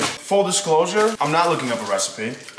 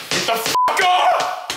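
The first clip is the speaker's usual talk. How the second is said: frustrated yell; much slower than usual. Real, not acted. Butter stuck on pan.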